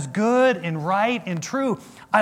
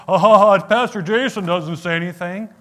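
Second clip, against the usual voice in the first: mocking vet; 6 dB louder than usual. Vets mocking the silent pastor.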